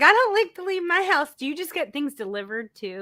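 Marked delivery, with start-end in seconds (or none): 0.0-1.3 higher-pitched mock homebody; teasing homebody impression. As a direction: gently mimic a friend who stays home. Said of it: "You're like".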